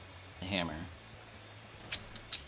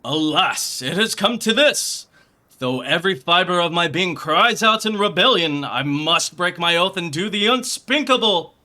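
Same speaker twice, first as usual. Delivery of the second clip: louder, grandiose gentleman detective voice; pompous inspector; 3 semitones higher, 7 dB louder than usual. Acted. Hildebrand greets Gilgamesh and plans the search, pompous and loud. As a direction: puff up like a self-important sleuth.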